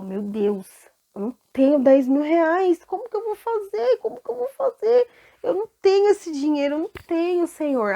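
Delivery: high, desperate cries of a debtor; panicked pleading servant. Acted. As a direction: beg the king for mercy, voice climbing. Debtor begs the king. (servo desesperado implorando ao rei, com a voz aguda)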